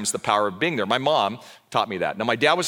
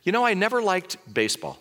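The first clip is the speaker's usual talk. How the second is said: lucid mother; 9 semitones higher than usual. Retold or imitated. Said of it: "clear as could be, and she said".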